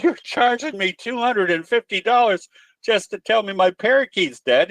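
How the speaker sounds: a shocked customer disbelieving the bill; outraged lady. Retold or imitated.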